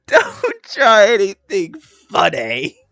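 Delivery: slow, loud, laughing mimicry; laughing quote. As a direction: repeat it through laughter. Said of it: "Hey, I have my eyes on you".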